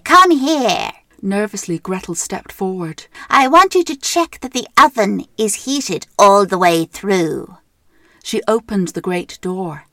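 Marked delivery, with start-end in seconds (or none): 0.0-0.9 loud high witch screech. Acted. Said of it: "she screeched at Gretel". 3.3-7.6 loud bossy witch. Acted. Witch orders Gretel.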